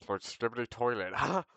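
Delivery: loud, high mock goading with a laugh; rising, laughing huh. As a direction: imitate a kid egging someone on. Imitates peers goading kid, laughing.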